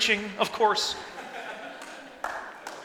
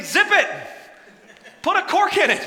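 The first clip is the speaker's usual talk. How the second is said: higher and louder, playful command; 9 semitones higher, 5 dB louder than usual. Acted. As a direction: snap it like an order to shut up. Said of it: "He's saying".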